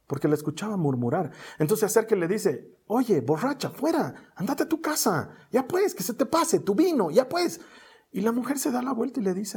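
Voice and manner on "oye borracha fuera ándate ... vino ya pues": higher-pitched mock scolding of a drunk woman; scolding priest. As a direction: shoo her off like a drunk, half-acted. (sacerdote que echa a Ana, regaño fingido)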